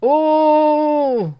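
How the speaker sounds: high, loud mock gasp; mock surprise. Reacting to the double oh seven reveal: a high, hammed-up gasp.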